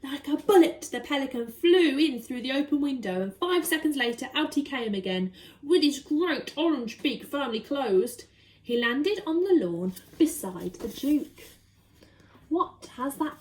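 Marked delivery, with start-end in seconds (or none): none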